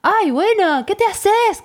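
lightly mocked teenage brush-off, high-pitched; high, petulant adolescent retort; sulky adolescent. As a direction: imitate a husband acting like a teenager. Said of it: "siguió medio como adolescente".

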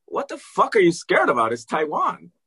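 high, exasperated retort; to his wife, mock disbelief; incredulous. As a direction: scoff at her fear. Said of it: "I told Liz".

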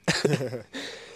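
hard choking cough. Real, not acted. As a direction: cough as if something went down wrong. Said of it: "je m'étouffe".

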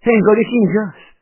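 high, loud alarmed exclamation; voiced woman's high shout of alarm; startled cry. A narrator's reading. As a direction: cry out in surprise. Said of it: "زنک که نفهمیده بود چه خبر شده داد زد".

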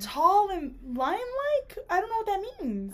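incredulous. Real, not acted. Mocking the book's phrasing.

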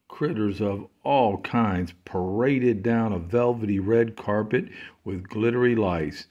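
ordinary delivery, nothing marked

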